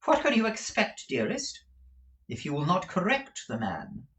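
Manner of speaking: lightly voiced coaxing wife; chiding wife. A narrator's reading. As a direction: gently scold your husband. Wife chides husband.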